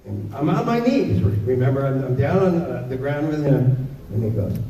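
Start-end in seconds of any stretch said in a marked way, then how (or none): none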